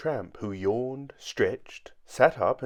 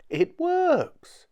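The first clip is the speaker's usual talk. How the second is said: high, loud squeak of amazement; loud wonderment; 7 dB louder than usual. A narrator's reading. Mister Jelly finds counting worked, amazed. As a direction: squeal it in delighted disbelief. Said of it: "said out loud in wonderment".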